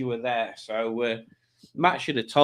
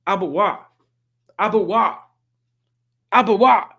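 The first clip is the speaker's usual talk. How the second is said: angry mumbled shout, building louder and higher with each repeat; slurred words, escalating into a mock yell; imitated mounting anger; 7 semitones higher, 7 dB louder than usual. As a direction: imitate someone getting angrier on the phone. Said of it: "just getting more and more angry".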